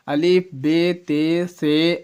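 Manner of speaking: drawn-out, sing-song letters; slow alphabet recital. Shown as a demonstration. Teacher reminding students of the alphabet, slow drill. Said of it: "word تو آپ بالکل جانتے ہی ہیں".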